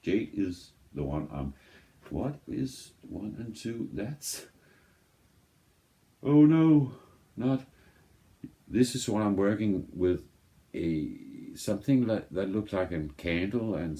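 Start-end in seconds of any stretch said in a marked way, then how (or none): 6.2-7.7 sudden high alarmed oh no; real startled cry, much higher and louder; surprised. Real, not acted. Sudden mishap with the record.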